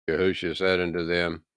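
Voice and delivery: usual speaking voice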